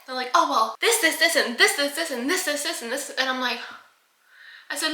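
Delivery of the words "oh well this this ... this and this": imitating a rambling upsell, slightly higher; mock sales spiel. Pest control rep pitching upsells, mock sales spiel.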